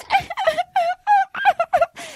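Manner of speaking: high-pitched fake sobs; hammed-up wailing; mock sobbing. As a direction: imitate crying in high stuttering bursts. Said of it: "She starts crying".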